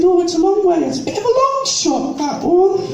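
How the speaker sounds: high, plummy posh lady voice; posh society lady greeting the jobseeker. Retold or imitated. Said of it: "she said".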